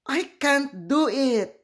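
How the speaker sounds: high, whiny little voice; a child giving up; frustrated little Yeti. Acted.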